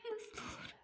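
high, whispered sob of disbelief; a stunned, crying whisper; broken whisper. Acted. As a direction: barely get the words out through grief. Grieving wife, disbelief.

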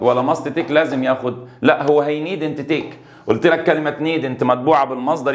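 ordinary delivery

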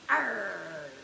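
low, drawn-out growl; pirate arr. Shown as a demonstration. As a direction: growl like a pirate for children to copy. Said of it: "Now can you say arg".

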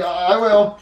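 high, loud, hurried agreement; obedient kid character. Acted. Junior promises the chef, hurriedly agreeing.

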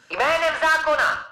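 high, distant loudspeaker call; tinny far-off megaphone order; police megaphone. Acted. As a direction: announce it through a helicopter megaphone. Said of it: "z jejího tlampače se ozvalo".

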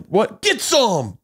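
egging yourself on, hammed up; playful impression of a keen player; mock hype. Mimicking Sebastian's softball reaction.

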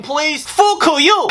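usual speaking voice

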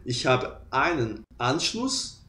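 "ich habe einen Anschluss" said slowly and softly; slow demo. Shown as a demonstration. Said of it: "você fala ali".

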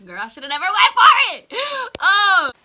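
high, very loud outburst with laughter; wailing regret. Real, not acted. Vlogger regretting she went for it, wailing and laughing. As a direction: howl it in comic regret. Loudly regretting her choice to viewers.

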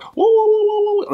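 high, loud wailing whoas; imitated chant wails. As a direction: mimic the soaring chant. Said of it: "with those kind of like".

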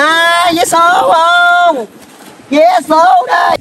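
high, long-held hawking call; vendor's street call. Acted. Lottery ticket seller hailing passing customers, hawking. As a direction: sing out the wares, stretching each word.

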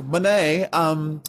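put-on British accent, polite and halting. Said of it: "why is [name] British now".

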